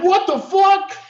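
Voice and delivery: high, loud, incredulous yell; loud disbelief. Retold or imitated. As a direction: shout it in total disbelief. People reacting in disbelief.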